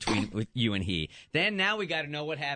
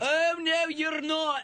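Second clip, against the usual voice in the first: very high Irish-accented mimic; squeaky Irish lilt, much higher; Irish accent, joining in; 14 semitones higher, much slower than usual. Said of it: "To give me an Irish accent, please".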